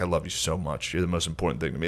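mock tender goodbye to his wife. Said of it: "him being like".

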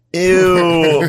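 high, loud, drawn-out disgust; mock squeal of disgust; disgusted girls. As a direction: imitate grossed-out teenage girls, loud and high. Voicing the disgusted team of girls.

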